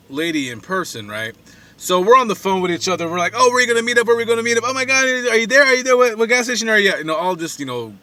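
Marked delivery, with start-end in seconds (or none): 3.3-7.0 high, excited rapid-fire questions; mock giddy chatter, pitched up and louder. Online daters on the phone before meeting, giddy. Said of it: "giddy stuff that, you know, people do".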